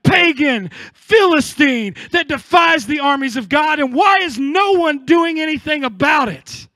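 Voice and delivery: slow, loud challenge, every word hammered; defiant shout. Acted. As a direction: shout the taunt right back. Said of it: "David is like".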